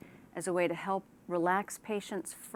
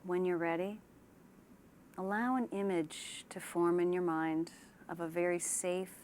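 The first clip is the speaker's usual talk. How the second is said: unhurried relaxation-exercise delivery; guided meditation; 4 dB quieter than usual. Shown as a demonstration. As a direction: ease them gently into calm, leaving space after each phrase. Leads a guided imagery relaxation.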